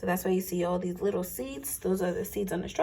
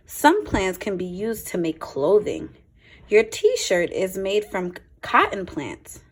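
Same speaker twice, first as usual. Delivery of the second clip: clear, lively picture-book read-aloud; a teacher's storytime voice, a touch brighter; reading to children; 5 dB louder than usual. Acted. Reads picture book to class.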